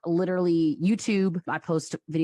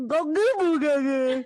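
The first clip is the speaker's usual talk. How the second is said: put-on much higher, baby babbling nonsense; toddler babble; 11 semitones higher than usual. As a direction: babble like a small child.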